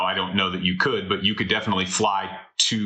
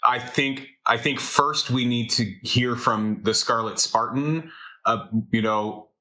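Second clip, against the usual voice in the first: usual speaking voice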